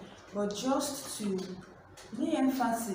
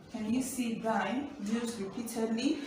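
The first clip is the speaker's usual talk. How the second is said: normal talking voice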